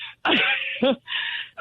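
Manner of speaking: a quick, quiet, airless laugh at her own joke; short wry laugh. Real, not acted. Grieving mother on the phone laughs at her own therapist joke, quiet and wry. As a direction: laugh softly, almost silently, then move on.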